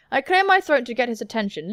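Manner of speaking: ordinary talk, nothing marked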